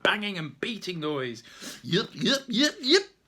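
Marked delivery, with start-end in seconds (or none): 1.8-3.2 high, loud yipping call; creature yipping. Acted. Magic creature calling as the fire is sucked away, yipping. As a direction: yip like a small excited creature.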